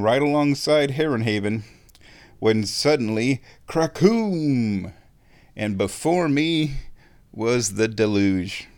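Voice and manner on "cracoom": thunder crack sound. Retold or imitated. Sudden thunder in the story.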